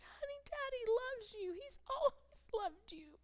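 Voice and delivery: high voice choked with tears; tearful reassurance. Real, not acted. Tearful mother reassuring crying son.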